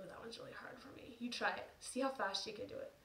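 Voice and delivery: normal talking voice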